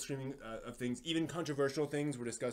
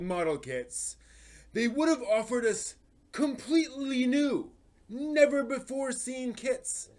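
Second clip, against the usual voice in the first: higher, louder, indignant ranting read; dramatic reading; 10 semitones higher, 10 dB louder than usual. Acted. Reading a twenty-year-old fan complaint to viewers, dramatic ranting. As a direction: perform the old forum post like a fed-up fan's rant.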